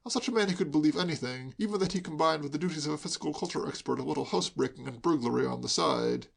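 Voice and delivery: usual speaking voice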